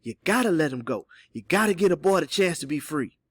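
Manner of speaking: plain reading voice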